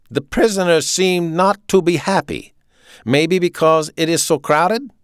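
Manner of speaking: loud, high German officer; authoritarian, punched-out German accent; staccato German accent. A narrator's reading. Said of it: "he spoke with a staccato German accent".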